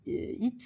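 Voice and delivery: "heat" said as "eat", French style; dropped H. Shown as a demonstration. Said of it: "the H in heat kind of disappears".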